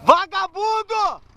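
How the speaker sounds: loud insult. Real, not acted. Insulting the broken vehicle.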